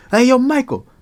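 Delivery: loud, high call across the field; shouting out. Retold or imitated. Calling out to friend Michael in the field, loud high shout. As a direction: yell to catch his attention.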